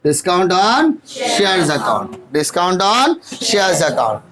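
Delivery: each word slow and separate for note-taking; slow dictation. Shown as a demonstration. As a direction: dictate slowly so students can write. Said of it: "इसको हमने discount on issue of shares बोलेंगे". (धीरे-धीरे बोलकर लिखवाना)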